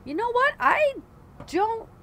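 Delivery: high, sudden "you know what"; jolted into a thought; high startled outburst. Real, not acted.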